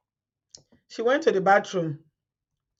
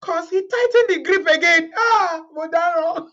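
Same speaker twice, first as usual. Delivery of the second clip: laughing shout; 7 semitones higher, 3 dB louder than usual. Real, not acted. Laughing at the story.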